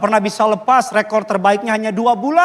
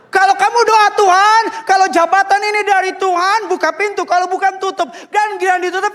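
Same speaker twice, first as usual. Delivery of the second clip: higher, impassioned preaching voice; 10 semitones higher than usual. Acted. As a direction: drive the point home to the congregation. Preaching climax.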